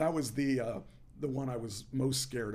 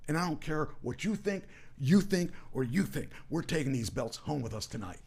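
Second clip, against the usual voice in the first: raised, punchy taunting boast; cocky wrestling promo; 4 semitones higher, 3 dB louder than usual. Retold or imitated. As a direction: taunt the crowd like a wrestling heel. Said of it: "the gimmick was that we were trying".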